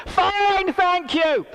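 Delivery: high, loud reply called across the water. Retold or imitated. Preacher answers the lady across the pool, loud high reply. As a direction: call back politely across the pool.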